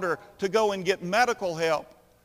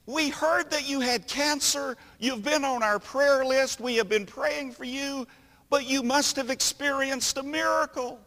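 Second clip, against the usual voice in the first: lightly voiced churchgoer, pitched higher; a higher, eager church-lady voice; a hint of a gushing church member, higher; church friend; 7 semitones higher than usual. Church member greeting Mister Bentley.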